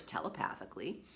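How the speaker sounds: ordinary delivery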